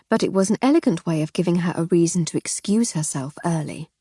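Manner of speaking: plain reading voice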